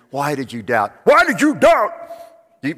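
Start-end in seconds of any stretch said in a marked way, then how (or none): 1.0-1.9 much higher, much louder scolding Jesus; Jesus scolding Peter harshly. Acted. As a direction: rebuke him sharply. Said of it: "how do you picture Jesus saying".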